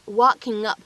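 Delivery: "walking up" joined, ng running into "up"; linked reading. Shown as a demonstration. Teacher offering first option, "walking up" linked through the ng. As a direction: link "walking up" as one word, keeping the ng. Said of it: "可以做一个自然的连读".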